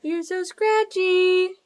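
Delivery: slow, high cooing. Real, not acted. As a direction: coo slowly at the scratchy cat. Petting the cat.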